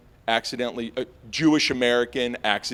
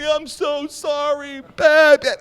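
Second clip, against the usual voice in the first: higher, slow, then very loud apology; hammed-up pleading to the cop; selling it; 10 dB louder, slower than usual. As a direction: beg theatrically. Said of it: "and I just sell it".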